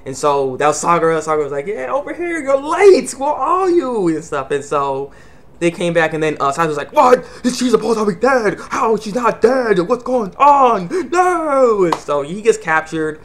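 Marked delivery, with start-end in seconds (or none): 1.7-4.1 high, strained holler; put-on high voice, shouting over; calling out. Sakura calling to late ally. 6.9-11.8 high, drawn-out mock outrage; hammed-up bewildered yell; shocked disbelief. Saito shocked, acted out.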